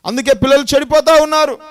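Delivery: emphatic loud sermon repeat. Acted. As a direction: punch the repeat with చెడిపోతా hit hard and falling. Repeated phrase, second louder.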